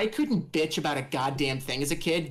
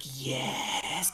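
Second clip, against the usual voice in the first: whispered yes; 4 dB quieter than usual. Retold or imitated. Slow triumphant fist clench.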